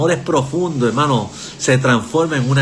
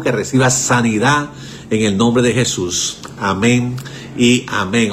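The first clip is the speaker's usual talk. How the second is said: prayer; 4 semitones lower than usual. Acted. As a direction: pray over the listeners, repeating Dios amado. Leads prayer on radio.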